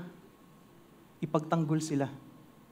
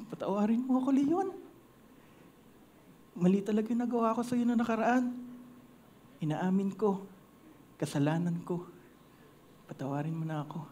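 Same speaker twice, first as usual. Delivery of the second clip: apologetic mouse; 8 dB quieter than usual. Acted. Mouse quietly asking the lion's forgiveness.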